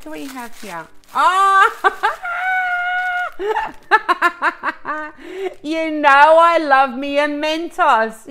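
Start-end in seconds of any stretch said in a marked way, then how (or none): 1.1-5.6 high, loud squeal breaking into laughter; a real burst of joyful laughing shriek; delighted shriek and laugh. Real, not acted. Delighted reaction opening the gift. 5.6-8.3 loud and slow, beaming with delight; thrilled exclamation. Real, not acted. Thrilled by the Mentos gift.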